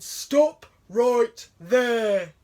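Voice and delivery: very slow, loud growling bear command; grumbling bear. A narrator's reading. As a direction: stop her dead like a huge bear. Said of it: "It grizzled and it grumbled".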